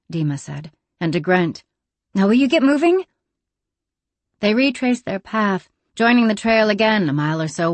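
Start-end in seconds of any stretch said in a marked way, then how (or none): 2.1-3.0 raised, exasperated urging; impatient. Acted. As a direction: push him to hurry up. Dima urging Grant impatiently.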